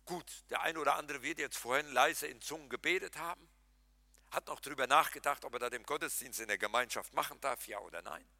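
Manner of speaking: ordinary talk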